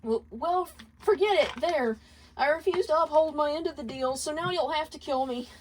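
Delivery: high, lightly tearful outburst with a jump up; a mild hint of wailing defiance. Moxie refusing the deal to Alistair, understated tearful wail. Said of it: "uncontrollable wailing".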